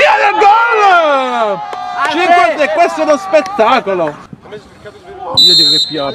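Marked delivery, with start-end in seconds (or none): 0.0-4.3 high, stretched goal call; excited goal shout. Real, not acted. Goal call, live commentary. (grido di gol)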